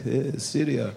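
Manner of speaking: put-on Syria with a tapped r, in accent; deadpan imitated Syrian accent. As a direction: mimic a Syrian man saying Syria. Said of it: "He's like".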